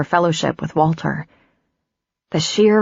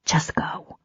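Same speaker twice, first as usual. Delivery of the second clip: whispered plea; 7 dB quieter than usual. Acted. Tearful dismissal.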